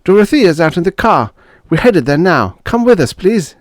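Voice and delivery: put-on young officer begging neighbours to flee. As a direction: beg them to come now. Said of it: "begged the young officer".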